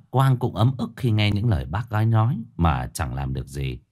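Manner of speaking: usual speaking voice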